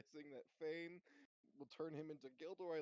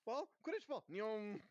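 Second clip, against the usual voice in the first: higher, yapping puppy excitement; excited dog voice; 6 semitones higher, 6 dB louder than usual. Pretend. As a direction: bark it like an eager dog. Wood as dog again.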